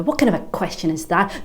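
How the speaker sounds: normal talking voice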